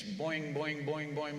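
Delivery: low, soft twanging boing; bowstring boing. Retold or imitated. Missionary mimics warriors testing bows, low boing. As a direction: imitate the bowstrings plucking. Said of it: "playing with the bowstrings".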